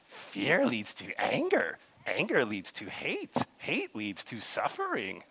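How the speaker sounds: a hint of Yoda, slow and measured; preacher lightly voicing Yoda warning Anakin, slow; Yoda impression. Slow, lightly voiced Yoda. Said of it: "I think Yoda says this".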